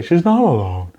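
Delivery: high, dramatic hammy repeat; high hammed-up heroic line; mock movie line. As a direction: intone it like a stiff hero. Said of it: "You wouldn't say".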